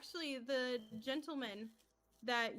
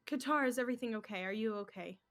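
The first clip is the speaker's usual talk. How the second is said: rushed worry; much faster than usual. Acted. GM voices worried woman.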